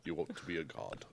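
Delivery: god-king voice. Pretend.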